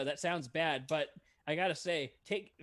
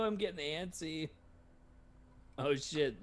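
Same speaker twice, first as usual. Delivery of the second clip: ordinary talk, nothing marked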